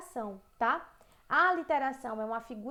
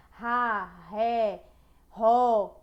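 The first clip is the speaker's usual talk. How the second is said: Ra, ré, ró punched out, louder each time; stressed syllables; 2 semitones lower, 7 dB louder, much slower than usual. Shown as a demonstration. Teacher drills the R syllables for the class, punched. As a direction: hit every R syllable harder. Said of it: "eu mudo as vogais, mas a consoante permanece".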